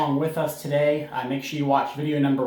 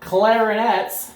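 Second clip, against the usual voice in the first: slow, louder, higher call; 6 semitones higher, 4 dB louder, much slower than usual. Real, not acted. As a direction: call the clarinets to attention. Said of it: "calling a section".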